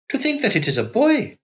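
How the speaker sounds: high, triumphant exclamation; exclaiming nurse. A narrator's reading. Proud nurse announcing the baby, high and triumphant. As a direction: crow it out in triumph. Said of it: "she exclaimed".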